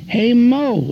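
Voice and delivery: high calling-out holler. Retold or imitated. Radioman buddies hailing him on Market Street, high holler. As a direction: call across the street. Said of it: "the guys hollered".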